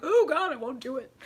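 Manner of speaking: hammed-up frightened surrender; mock scared. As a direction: act out caving to fear. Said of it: "Fear grabs you by the".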